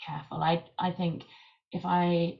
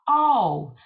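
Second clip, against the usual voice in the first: loud, high, swooping Welsh "Oh"; Nessa catchphrase; 5 semitones higher, 12 dB louder than usual. Acted. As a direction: launch into a Welsh accent with a big falling "Oh". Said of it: "you might go like".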